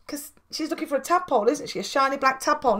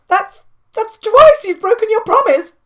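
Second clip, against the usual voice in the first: much louder, higher hurt outcry; crying out; 8 semitones higher, 12 dB louder than usual. Acted. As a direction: accuse him with a wounded cry. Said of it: "cried the caterpillar".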